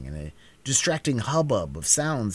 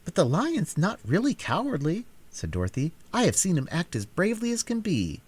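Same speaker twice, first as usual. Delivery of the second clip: put-on raised girlish voice, earnest; a little girl defending her friend; high, eager girl's voice; young girl Dorothy; 8 semitones higher than usual.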